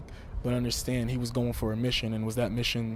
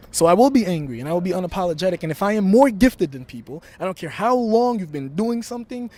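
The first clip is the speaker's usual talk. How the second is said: promo shout; 5 semitones higher, 8 dB louder than usual. Real, not acted. Wrestling promo, rising intensity.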